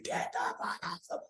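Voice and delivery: high, hushed mock scream; stifled yell of a drill instructor; imitated scream. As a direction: scream it under your breath, voice strained high. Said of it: "He starts screaming".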